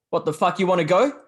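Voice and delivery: mock a street aggressor picking a fight; retold aggressive challenge.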